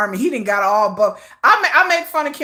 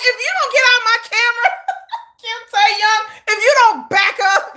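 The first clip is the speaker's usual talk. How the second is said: mock scolding; 11 semitones higher, 4 dB louder than usual. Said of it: "I'm like".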